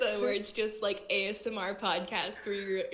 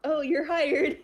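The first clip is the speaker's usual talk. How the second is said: louder, higher play-acted boss; mock boss; 6 semitones higher, 6 dB louder, slower than usual. Teasing a friend who said hire, mock boss voice. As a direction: announce it like a boss making a hire. Acts out boss hiring someone.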